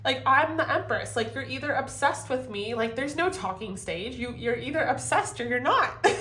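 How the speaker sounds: high, incredulous rant; exasperated outburst. Pretend. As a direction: let it burst out, disbelieving. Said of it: "you're like".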